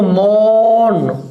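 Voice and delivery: मौन with a long, drawn-out O and no R; long O sound. Shown as a demonstration. Teacher shows the class मौन, slow long O without R. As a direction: hold the O in मौन, straight into N. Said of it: "ओ का".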